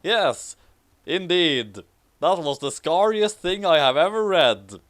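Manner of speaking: ordinary delivery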